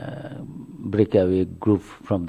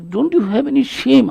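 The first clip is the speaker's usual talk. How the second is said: much higher, louder reproach; indignant scolding; 5 dB louder than usual. Retold or imitated. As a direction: throw the question at him in disbelief. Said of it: "started scolding Sri Ramakrishna".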